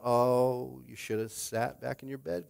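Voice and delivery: ordinary delivery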